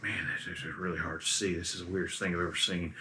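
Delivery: quick low mumble; spooked captain mumbling in the fog. Acted. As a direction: mutter it to yourself, spooked. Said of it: "He's kinda mumbling about the whole thing".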